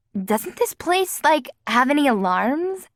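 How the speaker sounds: a lightly voiced anxious girl; Kara asking. A narrator's reading. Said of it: "Kara asked".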